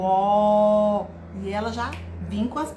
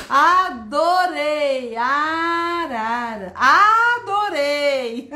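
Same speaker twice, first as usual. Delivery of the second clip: very slow, stretched opening vowels; gush, holding every first A long and strong; delighted praise; 5 dB louder, much slower than usual. Shown as a demonstration. Teacher loving the participations, delighted and drawn out.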